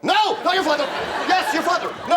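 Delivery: high-pitched, panicky flip-flopping; paranoid. Acted. Father frantically takes back his confession, paranoid. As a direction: jump up high in paranoid alarm. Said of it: "Both of you have to be extremely paranoid".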